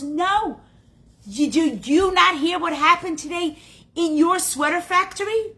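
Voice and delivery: high, loud, agitated question; urgent disbelief. Acted. Cousin asks Rose if she heard the news, agitated. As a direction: press the question with shocked urgency.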